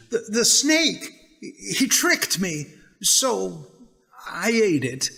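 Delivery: high, stammering blame; woman blaming the snake, flustered; nervous excuse. Acted. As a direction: stutter out the excuse.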